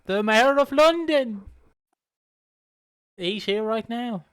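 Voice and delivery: high, loud mock-posh announcement; posh accent. Pimp announcing an important guest, hammed-up posh accent. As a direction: proclaim it in slow, grand posh English. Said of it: "I want him to be posh".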